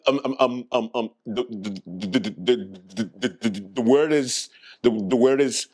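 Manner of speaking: repeated stuck stammer on little words; stammering moderator. Acted. Mock moderator struggling to announce the word, stammering. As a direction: stumble over and over before the word. Acting the spelling bee moderator.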